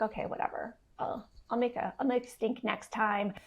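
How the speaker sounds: soft, sulky giving in; grudging ego. Pretend. Voicing her ego as it calms down, soft and sulky. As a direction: mutter it like a sulking child backing down.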